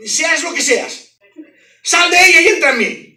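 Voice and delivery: loud, high commanding cry to the spirit; orden de exorcismo gritada. Retold or imitated. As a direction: order the spirit out at full voice. (grito de mando para expulsar al espíritu)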